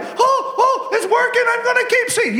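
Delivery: very high shouting joy; ecstatic praisers. Retold or imitated. Praisers freaking out with joy, very high excited shouts. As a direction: squeal with delight it's working. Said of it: "They're like".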